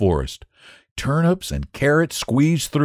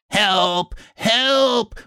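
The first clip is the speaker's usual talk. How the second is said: high, drawn-out cry for help; high wail, climbing on the second cry; bawling; 11 semitones higher than usual. A narrator's reading. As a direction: bawl for help like a captured little creature. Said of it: "bawled Potato when he saw he was a prisoner".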